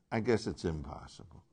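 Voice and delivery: low, soft, falling away on "impossible"; resigned acceptance. Shown as a demonstration. Teacher tracing Jesus' shift, quietly resigned. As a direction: drop the voice and concede "impossible". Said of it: "He's moving toward obedience".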